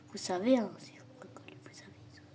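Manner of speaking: voiceless teasing warning; hushed joking menace, no voice at all; mock-threatening whisper.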